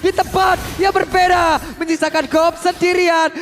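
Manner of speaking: loud, excited play-by-play shouting; esports caster hype. Real, not acted. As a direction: call the teamfight at full hype.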